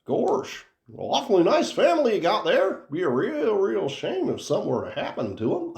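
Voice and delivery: high, innocent-sounding Goofy making a mob threat; sweet cartoon dog delivering a gangster threat; threatening Goofy. Host shows the co-host why Goofy sounds threatening, high and sweetly menacing. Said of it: "Goofy sounds very threatening".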